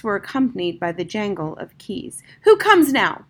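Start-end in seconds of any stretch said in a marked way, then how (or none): 2.4-3.3 loud, commanding shout from a cell. A narrator's reading. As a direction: call out into the dark corridor. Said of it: "shouted the magician".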